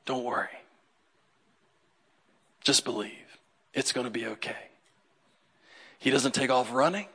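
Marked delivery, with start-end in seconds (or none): 0.0-4.7 low, soft and unhurried; calm reassurance. Retold or imitated. Jesus calming the grieving father, low and soft. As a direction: settle his fear gently. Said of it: "Jesus looks at him and says".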